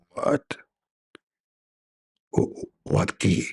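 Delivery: a cranky angel snapping down the line; gruff angel Jibreel. Acted. Angel Jibreel answering caller, gruff and annoyed.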